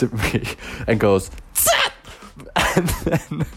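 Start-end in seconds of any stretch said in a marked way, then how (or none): 1.5-1.9 a sharp high shush to silence someone; shushing hiss. Retold or imitated. Woman on temple floor shushing the sneezer, sharp hiss. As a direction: shush the noisy visitor fast.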